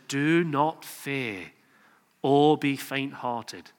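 slow, gentle reassurance; tender, unhurried comfort; speaks softly. Retold or imitated. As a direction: ease the fear out of him, very slowly. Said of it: "he speaks softly".

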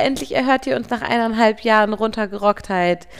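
ordinary talk

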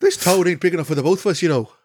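loud, high Irish cowboy voice; Irish accent. Acted. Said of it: "Irish cowboys. I always jumped to Irish".

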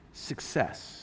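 very soft, low near-whisper; hushed, reverent utterance; hushed divine word. Acted. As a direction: let the word drop softly, like God speaking. Voices God speaking into a life.

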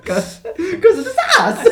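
high, laughing, drawn out. Real, not acted. Co-host laughing while finishing the joke, giggly. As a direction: squeeze it out through giggles.

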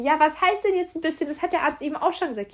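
high, insistent questioning; retold frustrated demand for clarity; exasperated. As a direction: push back in disbelief. Exasperated retort to vague diagnosis.

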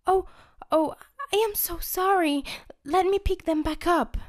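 put-on high, sweet flustered girl; candy girl voice. Candy girl apologizing to Chubby for dropping pumpkins.

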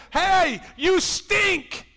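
loud, high, pushy rebuke; hammed-up harsh Jesus; mock scolding Jesus. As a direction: bark it like a preacher scolding a sinner. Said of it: "Jesus didn't come and say".

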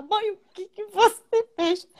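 high, slow, stunned daughter; imitating the shocked daughter. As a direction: drag it out in high disbelief. Filha vê o cabelo da mãe. (filha chocada, aguda e lenta)

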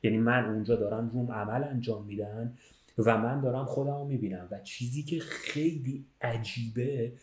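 usual speaking voice

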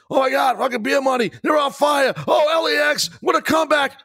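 screaming play-by-play call; excited commentator shout. Retold or imitated. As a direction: yell it like a hyped announcer.

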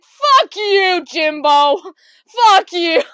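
high, hammed-up furious scream; angry outburst. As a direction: scream at dad in mock fury. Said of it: "I was so angry".

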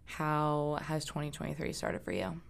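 usual speaking voice

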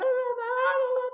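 high, loud mock wail; imitando gritos llorosos. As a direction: imitate a hysterical child crying out for mum. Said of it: "viene gritando y llorando". (imitando a una hija que grita llorando)

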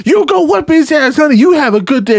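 high-pitched, fervent cheering on; excited, over-the-top phone sign-off; hyped-up send-off. Retold or imitated. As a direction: send them off fired up. Acting out the hyped-up phone exchange.

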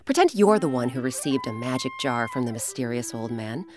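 ordinary delivery, nothing marked

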